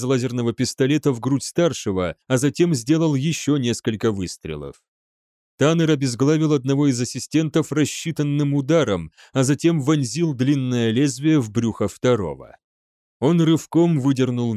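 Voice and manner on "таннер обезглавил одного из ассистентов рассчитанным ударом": ordinary delivery, nothing marked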